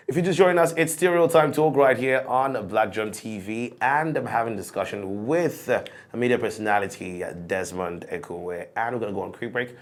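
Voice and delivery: upbeat presenter voice, steady and clear; show host break announcement. Acted. Host to viewers before the break, presenter voice. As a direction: tease the next topic like a TV host.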